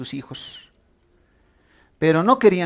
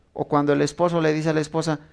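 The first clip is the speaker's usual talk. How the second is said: usual speaking voice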